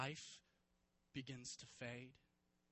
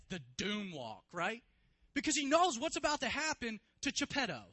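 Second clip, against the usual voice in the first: rising sermon, much louder and higher; preaching; 6 semitones higher, 12 dB louder than usual. Acted. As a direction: build it like a pulpit crescendo. Preacher builds the scene.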